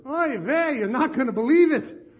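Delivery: put-on a high, loud Yiddish-accented "Oy vey" punchline. God answers the praying men in a Yiddish accent. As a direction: land the punchline with a Yiddish groan. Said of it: "they begged me not to do the accent".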